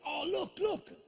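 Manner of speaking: high-pitched heckling yelp; loud excited lad. Retold or imitated. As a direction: call out in a high, pointing shout. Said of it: "one of them really loudly went".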